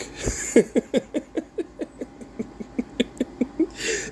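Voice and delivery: long airy wheezing laugh; long breathy laugh. Real, not acted. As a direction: let out a long voiceless laugh. Laughing in disbelief.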